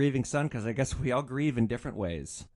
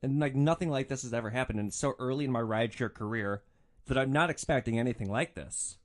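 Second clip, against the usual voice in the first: ordinary delivery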